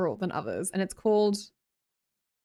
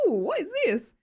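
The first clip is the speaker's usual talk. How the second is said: high-pitched acted "ooh" of surprise; pleasantly surprised; 8 semitones higher than usual. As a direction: play delighted surprise at a treat, pitched up. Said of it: "I'm always pleasantly surprised".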